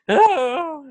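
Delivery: high pained yelp; pained cry. Acted. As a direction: cry out as the spear hits. GM voices Simon hit by spear.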